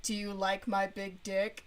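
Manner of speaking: low, deadpan mock come-on; dry and blunt. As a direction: imitate a blunt guy in a low monotone. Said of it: "his lines were very dry and very blunt".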